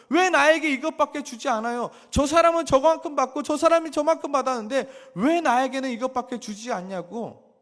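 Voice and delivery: loud, high-pitched outcry of grievance against God; acted-out angry pleading, shouted high; resentful complaint. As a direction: cry out in bitter protest at God. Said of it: "하나님을 원망하며".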